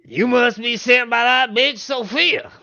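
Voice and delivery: high, loud indignant farmer voice; angry farmer. Acted. Farmer accusing the adventurers, loud and high. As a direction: spit out the name with contempt. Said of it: "I like the way you said bitch".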